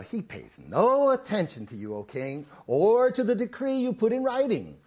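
emphatic, projected Bible narration; scripture reading. Acted. Preacher reading Daniel's story to his congregation, scripture reading. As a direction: read it out with weight on key words.

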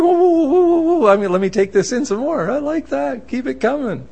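high, gleeful string of whoas; thrilled. Acted. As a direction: act overjoyed at a windfall. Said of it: "You're thrilled".

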